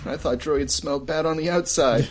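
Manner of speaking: ordinary talk, nothing marked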